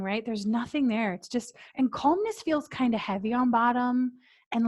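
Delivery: usual speaking voice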